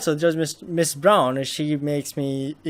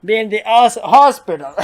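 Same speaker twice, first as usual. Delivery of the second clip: loud, higher, bursting into laughter; laughing; 7 semitones higher, 11 dB louder than usual. Real, not acted. Player repeating hospital joke about old man, laughing loudly. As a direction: blurt it out and crack up.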